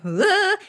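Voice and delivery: high-pitched imitated "ugh" of nerves; nervous shudder. Nervous nurse walking in to a patient, mock-panicked high groan. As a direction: act out a jittery shudder. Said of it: "and my hands are shaking".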